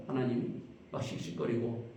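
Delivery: ordinary delivery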